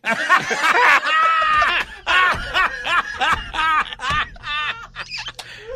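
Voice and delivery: loud, high-pitched real laughing fit; hysterical laughter. Real, not acted. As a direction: lose it completely, laughing. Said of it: "I literally was losing my shit".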